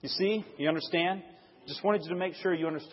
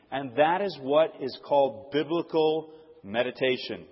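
usual speaking voice

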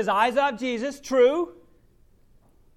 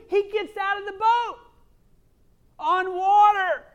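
higher and louder, slowing on the last words; amazed; 10 semitones higher, 7 dB louder than usual. Real, not acted. Preacher to congregation, amazed and much louder. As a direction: marvel at it out loud. Preacher marvels at Peter's feat.

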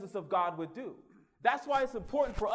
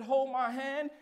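raised preaching cry; preached plea; 6 semitones higher, 5 dB louder, slower than usual. Retold or imitated. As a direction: hold my hand cried higher and louder. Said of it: "I said".

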